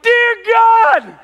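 high, loud cry of pain. Retold or imitated. Pastor acting out waking with a sore shoulder, pained cry. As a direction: yelp as the shoulder hurts.

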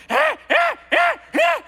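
high, loud ape chatter; monkey calls. Retold or imitated. Monkeys in the trees answering the teens, high loud calls. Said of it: "the monkeys are up in the trees going".

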